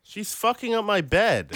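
high, slow complaint; pained, helpless whine; real exasperation. Real, not acted. Dog wrecking his bed.

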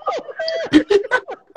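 squealing laugh, way up high; high-pitched burst of laughter. Real, not acted. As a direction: crack up at his own punchline, high and breathy. Cracking up at punchline.

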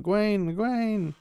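high, drawn-out mock agonized call; imitating pained groaning. Rand in pain calling to his wife, imitated agonized groan. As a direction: call out the name as if groaning, lightly acted. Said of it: "he's groaning in pain and agony".

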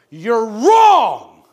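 high, furious preacher's rebuke; angry shout. Retold or imitated. Old preacher rebuking the band leader, furious shout. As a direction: throw him out at full volume.

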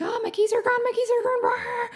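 high, whiny mock panic over lost keys; panicky key searcher. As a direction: play the frantic person who can't find the keys. Panicked searcher voiced mockingly.

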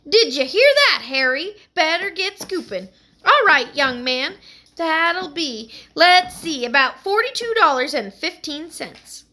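laughing New York shopkeeper, high and loud; New York accent. Acted. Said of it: "her New York accent".

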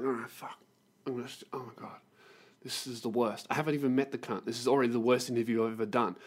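low, hushed grumble to oneself; retold quiet despairing aside; muttered dismay.

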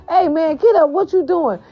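high-pitched retold crew yell; acting out an alarmed crewmate; urgent rousing. Crew rousing fainted man in retold story.

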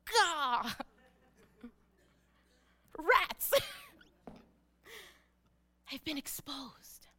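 comic gasp, then a whispered admission; mock dismay at exposure. Woman caught touching Jesus, comically dismayed. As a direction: play caught out, dropping into a whisper. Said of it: "And she's like".

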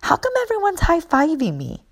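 put-on high, curious little-kid voice; a mother voicing her small son, high and wondering; little boy voice. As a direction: ask it like a puzzled five-year-old. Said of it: "my son goes".

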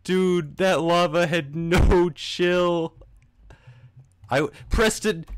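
high, loud, slow outrage; protest, still laughing; outraged disbelief. Real, not acted. Complaining about lava.

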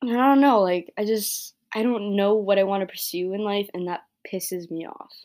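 ordinary talk

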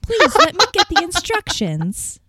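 very high, loud sugary innocence; syrupy sweet. Retold or imitated. Manager denying sarcasm to an angry caller, syrupy sweet. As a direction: lay on fake sweetness, pitch way up. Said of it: "my voice just kept getting sweeter".

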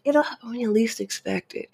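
high mock sing-song of a well-meaning friend; lightly voiced advice-giver, sing-song and high.

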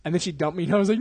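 usual speaking voice

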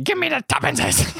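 put-on high, grabbing old man voice; snatch it like a crazed old miser; greedy old banker. Old banker snatching the boy's money, high greedy voice. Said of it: "And is like".

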